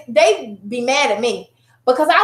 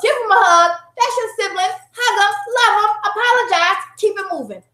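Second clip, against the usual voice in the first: higher-pitched string of commands; brisk mom orders; 7 semitones higher than usual. Retold or imitated. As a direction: hurry the kids into making up. Acts out quickly settling siblings' quarrel.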